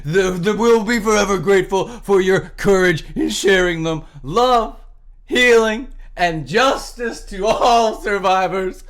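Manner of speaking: fake-weep through the statement to mock it; sarcastic sobbing sincerity; utter contempt. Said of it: "read this last sentence with utter contempt".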